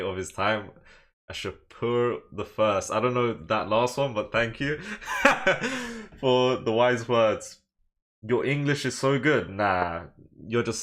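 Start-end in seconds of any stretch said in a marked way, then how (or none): 4.8-6.1 high-pitched chuckle; amused high giggle; laugh. Real, not acted. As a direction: burst of high laughter mid-thanks. Laughs while thanking chat.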